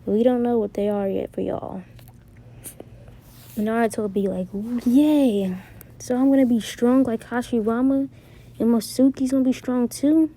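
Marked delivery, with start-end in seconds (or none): 4.9-10.3 higher, louder, lightly acted boyish excitement; excited cheer. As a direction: cheer like an excited kid, pitched up. Naruto voiced, thrilled about new powers.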